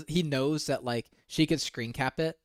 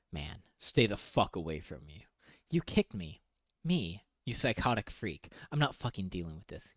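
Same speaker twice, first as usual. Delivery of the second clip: lower, softer reading; low quiet read; 7 semitones lower, 9 dB quieter than usual. Retold or imitated. Reading his texts to viewers, low and soft. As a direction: read it under your breath.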